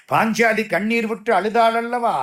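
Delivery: normal talking voice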